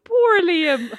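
mock wail through laughter; laughing pity. Real, not acted. Laughing sympathy for Liam.

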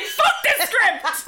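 laughing mock defiance. Joking about ignoring scripts.

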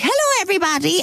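high, bright puppet greeting; Bosco puppet voice. Retold or imitated. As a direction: chirp it like a children's TV puppet. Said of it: "And he was like".

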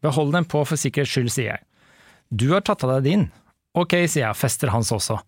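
measured read-aloud narration; story reading. Acted. Host reads the book's ending to co-host, story reading. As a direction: read the novel out clearly and evenly.

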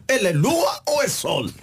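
slow, exasperated high question. Real, not acted. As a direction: demand it in disbelief. Exasperated at song lyrics.

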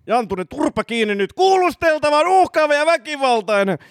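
very high, strained acted outburst; kuulustelija, raivostunut. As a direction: shut the suspect up and report them as dangerous. Inspector shouts down the suspect. (raivostunut, hätääntynyt)

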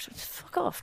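under the breath; muttered aside, barely voiced. Muttered retort.

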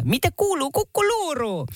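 high sing-song vendor's call; imitating a local. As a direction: call it out like a tourist hawker. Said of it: "paikalliset on aina". (matkii paikallista huutelijaa)

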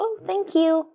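very high, sweet mock thanks; flattered reply. Her own reply to the flirting stranger, high sweet mock. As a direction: squeak it out, flattered. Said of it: "Så jag bara".

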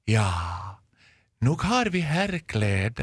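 slow, drawn-out storybook elder; fairy-tale old woman. Retold or imitated. Older shop woman from a fairy tale answering a customer, slow storybook voice. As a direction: answer very slowly, with long pauses. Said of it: "som kom in i en sagovärld".

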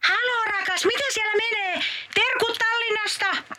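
high, bubbly wife chattering from Tallinn; a giddy, high-pitched holiday wife; Joulumuori voice. Acted. Wife calling Pukki from Tallinn, high and cheery.

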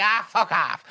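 high-pitched acted brush-off; dismissive retort. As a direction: wave her away as the cheeky granny. Granny's dismissive retort, retold.

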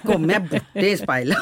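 ordinary talk

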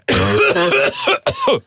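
high harsh cough; real coughing fit. Real, not acted. Host choking on smelling salt, coughing. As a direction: cough as the ammonia hits.

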